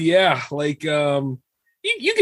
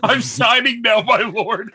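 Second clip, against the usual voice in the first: laughing mock servant; 5 semitones higher, slower than usual. Usher answering Satan, laughing.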